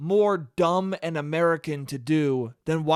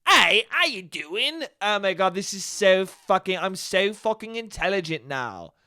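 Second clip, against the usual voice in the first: put-on British accent. Superfan parroting British TV to feel intelligent, in a mock British accent. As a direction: imitate an American aping a British accent. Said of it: "what is that accent you're doing".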